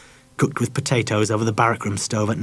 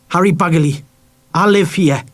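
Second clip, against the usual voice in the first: put-on high, louder boyish snap; angry, bitter boy; 6 semitones higher, 5 dB louder than usual. A narrator's reading. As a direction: defend his home bitterly. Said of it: "He said it angrily, bitterly".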